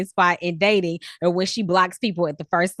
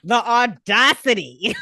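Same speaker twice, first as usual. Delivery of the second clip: put-on Valley Girl accent. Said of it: "this Valley Girl accent".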